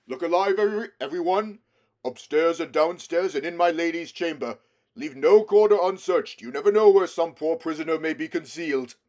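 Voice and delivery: rally the troops loudly; rousing command. A narrator's reading. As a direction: Aslan rousing the freed creatures to search. Aslan commanding the crowd.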